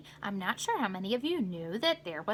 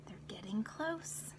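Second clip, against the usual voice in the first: unvoiced whispered aside; soft secret whisper; 3 semitones lower, 12 dB quieter than usual. Real, not acted.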